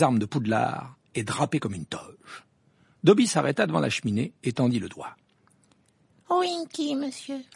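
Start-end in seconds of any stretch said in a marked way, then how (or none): 6.3-7.4 squeaky elf voice. A narrator's reading. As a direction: squeak it happily as a tiny elf. Dobby's held voice.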